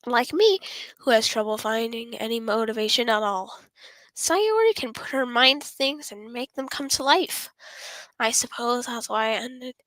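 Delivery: ordinary delivery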